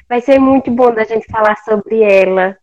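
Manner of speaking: normal talking voice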